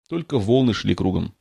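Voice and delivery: ordinary delivery, nothing marked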